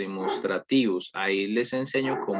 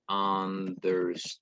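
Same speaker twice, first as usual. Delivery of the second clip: slow model word; 2 semitones lower, 4 dB quieter, much slower than usual. Shown as a demonstration. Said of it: "teacher models the word".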